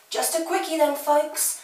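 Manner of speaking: loud, high call to a class. Acted. Art teacher calls to class.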